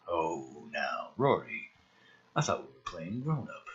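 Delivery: a hushed, condescending villain; low, soft menacing villain. Acted. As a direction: talk down to the kid, quiet and cold. Traeger again.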